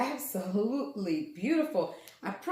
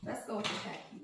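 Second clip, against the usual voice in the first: half-whispered; 2 semitones lower, 8 dB quieter, faster than usual. Real, not acted. Repeated, whispered take.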